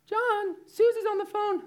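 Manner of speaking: mock mother's high voice, called out; imitating mom. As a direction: mom calling her son to the phone, in a hammed-up high voice. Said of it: "It's my best guess".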